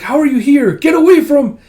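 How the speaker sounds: high, loud angry cry; angry outburst. Acted. Kippa confronts an unwelcome visitor, angry outburst. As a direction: shout it in a shrill-pitched protest.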